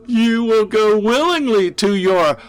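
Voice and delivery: high, loud and slow gloating madman; insane glee. Acted. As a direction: savour the doom with manic delight. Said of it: "the sound of his insane glee".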